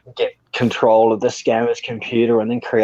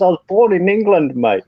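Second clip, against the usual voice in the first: higher-pitched imitation English accent; mock Pommy accent; 8 semitones higher than usual. Indian man in Thailand explains himself, mock Pommy accent. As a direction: put on a raised British bloke's accent. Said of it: "he had this Pommy accent".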